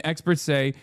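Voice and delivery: ordinary delivery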